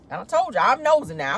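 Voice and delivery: normal talking voice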